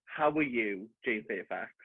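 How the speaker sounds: soft, low call to a spirit; mock séance. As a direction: summon her quietly, with mock solemnity. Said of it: "I'm channeling".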